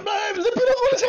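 high, laugh-cry pleading; half laugh, half sob denial; laughing whimper. Acted. Caught robber pleading with the police chief, laughing and crying.

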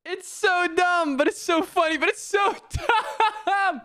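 high, drawn-out wail cracking into laughter; half-laughing high-pitched moan; laughing lament. Real, not acted. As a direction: whine it high, torn between dismay and laughing. Laughing and lamenting own blunder.

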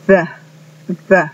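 the with tongue between teeth; English th. Shown as a demonstration. Teacher drilling the word the for students. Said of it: "las palabras que tienen T H es".

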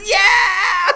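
high, loud shout of Yeah; mock crowd cheer. Shown as a demonstration. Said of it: "Everyone cheers".

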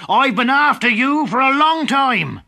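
a hunter who caught his prey; loud angry man; angry woodcutter. Acted. Woodcutter confronting wolf.

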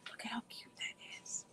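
breathy awed whisper; whispered delight over a necklace. Real, not acted. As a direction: hush it like a little secret. Admiring necklace on camera.

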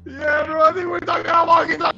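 loud, fast and high, thrilled; excited. Real, not acted. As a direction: blurt it out with glee.

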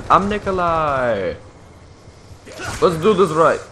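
put-on a gamer's clumsy Russian accent as Nikolai; bad Russian accent. Gamer voicing his character Nikolai in a bad Russian accent. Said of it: "That was the worst Russian accent ever".

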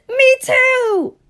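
very high, loud squeak; squeaky high voice. Acted. Montana agreeing with Illinois, very high squeak.

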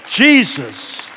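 loud, high proclamation; full-force preaching. Real, not acted. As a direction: declare it boldly from the pulpit.

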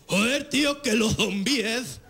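mock Castilian accent with lisped z; laughing fake Spanish accent. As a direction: ham up the Spain Spanish zombie actor. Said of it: "con un acento español".

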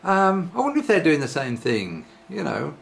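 normal talking voice

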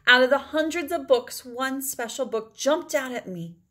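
ordinary delivery, nothing marked

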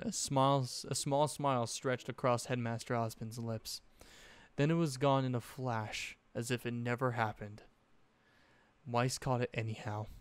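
ordinary delivery

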